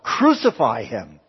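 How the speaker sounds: loud preacher's cry, dropping away at the end; crowd crying out. Retold or imitated. Preacher quoting the crowds in John's Gospel, loud drawn-out cry. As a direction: call it out slowly and forcefully like the mob. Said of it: "when the crowds cry out".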